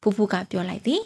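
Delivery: usual speaking voice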